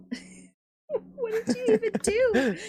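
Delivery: high, slow laughing question; laughing disbelief. Real, not acted. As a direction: ask through laughter, incredulous.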